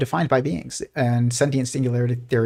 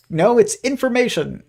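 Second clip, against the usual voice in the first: higher squeaky cartoon bickering; arguing cartoon physicist; 9 semitones higher than usual. Cartoon physicist arguing with another, higher insistent voice. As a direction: play a cartoon scientist insisting, pitched up. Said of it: "he's like".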